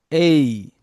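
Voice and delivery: letter A said "এই", a long ay glide, loud and clear. Shown as a demonstration. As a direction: demonstrate "এই" slowly, not "এ". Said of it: "এখানে আমরা এই বলবো". ("এই" স্পষ্ট করে উচ্চারণ)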